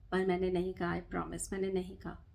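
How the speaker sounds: ordinary talk, nothing marked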